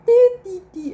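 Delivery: put-on very high, loud toddler babbling; squeaky baby sounds; baby babble. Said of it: "recording of their child making sounds".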